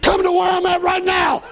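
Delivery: high, loud angry challenge; angry shout. Retold or imitated. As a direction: yell it out as a dare. Retold phone challenge.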